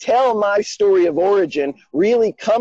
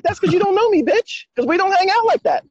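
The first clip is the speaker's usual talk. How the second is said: defiant retort; 12 semitones higher than usual. Retold or imitated. Retorting to friend, mock-defiant.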